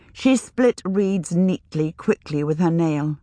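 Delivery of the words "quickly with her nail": plain reading voice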